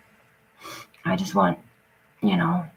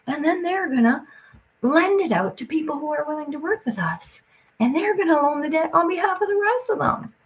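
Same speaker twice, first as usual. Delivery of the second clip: higher, sing-song mock of smug planners; higher mock voice of the money men; mocking the officials; 8 semitones higher than usual. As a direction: parrot them in a high, smug voice. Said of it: "They can't run out and say".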